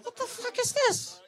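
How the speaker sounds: very high, shocked yelp; startled outburst. Retold or imitated. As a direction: jump out of your skin at the creepy kid. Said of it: "He's like".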